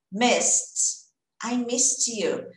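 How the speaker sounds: missed with a final T sound, I missed you slowly; slow model of I missed you, T at the end; past tense missed. Shown as a demonstration. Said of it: "Como que eu falo?".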